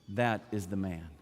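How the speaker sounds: low, soft, measured accusation; name the villain slowly and quietly; hushed, deliberate acted verdict. Esther accuses Haman to the king.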